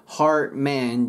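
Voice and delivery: "Heart man" with the stop swallowed; dropped D. Shown as a demonstration. Teacher showing the silent D, stop swallowed. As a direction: say "Heart man" as "har-men". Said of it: "D消えます".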